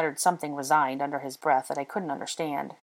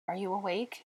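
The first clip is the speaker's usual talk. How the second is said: soft, higher, tentative call; hoarse call; 4 semitones higher, 7 dB quieter than usual. A narrator's reading. She calls to him at night, softly hoarse. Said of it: "I called hoarsely".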